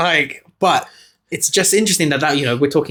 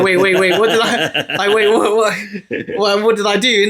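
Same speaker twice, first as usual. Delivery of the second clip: startled professor; 5 semitones higher than usual. Retold or imitated.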